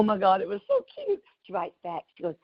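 ordinary talk

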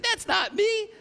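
high-pitched mock protest; hammed-up wounded denial; indignant denial. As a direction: squeak it out defensively.

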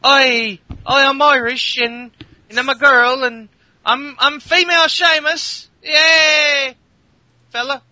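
exaggerated Irish accent cheering; mock Irish accent. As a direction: cheer it like a rowdy Irish lass. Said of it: "she's Irish anyway, so she's like".

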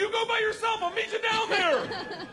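high, hollered bargaining; panicked shouting. Retold or imitated. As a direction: shout it frantically to get out of jumping. Panicked refusal before the jump.

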